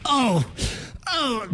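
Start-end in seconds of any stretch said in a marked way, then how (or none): 0.0-0.4 pained cry. Real, not acted. Pain after the game.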